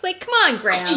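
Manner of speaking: high, loud mock-exasperated appeal. Host telling Grams off about Jen, exasperated. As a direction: throw it out in loud, pleading exasperation.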